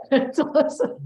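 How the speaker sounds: a laugh running into the words; real light laugh. Real, not acted. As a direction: chuckle it out with delight. Laughs at jack of all trades.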